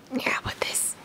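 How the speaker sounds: whisper. Real, not acted. Unvoiced aside.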